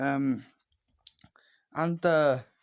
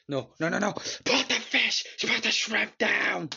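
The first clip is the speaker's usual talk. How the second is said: high, loud, slow command; exaggerated imitation of an angry boss; mock furious order; 9 semitones higher, 4 dB louder, slower than usual. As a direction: order him forcefully, hamming up the movie boss. Acting Don forcing Lenny.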